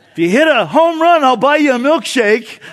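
high, loud laughing yell from behind the plate; yelling mom. Retold or imitated. Mom behind home plate yelling to her son, laughing. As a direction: call it out across the field, laughing. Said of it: "she'd yell out".

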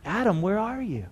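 soft, sorrowful call; hushed, aching fatherly question; heartbroken father. Shown as a demonstration. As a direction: call quietly for a lost child, heartbroken. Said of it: "the cry of a heartbroken father".

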